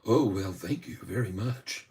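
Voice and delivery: bashful thanks. Real, not acted. Host thanking the Snapchat girl, soft and bashful. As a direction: shyly accept the compliment. Reply to a compliment.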